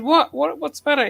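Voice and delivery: high, confused mock reply; retold TV character. Friend retelling a comedy scene, baffled captain. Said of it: "He says".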